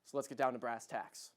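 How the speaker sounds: hushed, low mock gravity; mock serious. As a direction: drop low and quiet, feigning solemnity. Host turns mock solemn.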